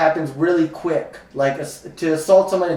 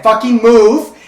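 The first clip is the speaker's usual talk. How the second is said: louder, higher blunt order; blunt yell; 6 semitones higher, 9 dB louder, slower than usual. Shown as a demonstration. Explaining how he handles danger, a blunt loud yell. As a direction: snap it out fast and blunt. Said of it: "bluntly and quickly".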